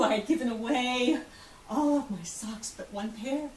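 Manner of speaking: ordinary talk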